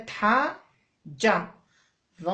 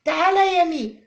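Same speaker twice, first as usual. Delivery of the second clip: loud, higher call to come over; 8 semitones higher, 8 dB louder than usual. Pretend. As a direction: summon her like a pupil. Teacher calls letter like pupil. (نداء عالٍ لتلميذ)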